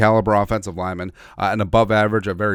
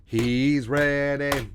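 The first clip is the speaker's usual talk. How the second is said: very slow, stretched-out hype call; hammed-up, elongated proclamation; drawn-out mock announcement; much slower than usual. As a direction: stretch every vowel out long and sustained. Drawn-out catchphrase-like chant about Risner.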